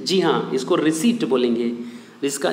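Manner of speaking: ordinary talk, nothing marked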